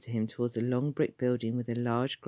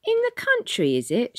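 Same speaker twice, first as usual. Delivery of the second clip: very high, loud Cockney conductress; shouted; 7 dB louder than usual. A narrator's reading. Conductress raising her voice to Tom, shouting. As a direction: speak up to be understood. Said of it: "she shouted".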